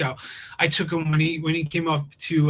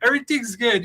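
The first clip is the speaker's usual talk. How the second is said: chirping up encouragement; 8 semitones higher than usual. Retold or imitated. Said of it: "you hear me chirping up in the back".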